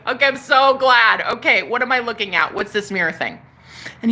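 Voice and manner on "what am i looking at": normal talking voice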